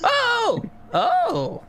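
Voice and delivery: high startled double oh; a jolly merchant caught off guard; startled tinkerer. Acted. Tinkerer seeing the skeleton, high and startled. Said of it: "He sees you and goes".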